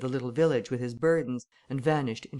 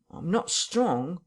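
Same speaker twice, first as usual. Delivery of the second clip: slow, higher, feeble whine; 4 semitones higher, much slower than usual. Acted. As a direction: drag it out pitifully. Marvel pleads weakness.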